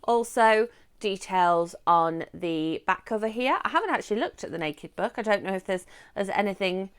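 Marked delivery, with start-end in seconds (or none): none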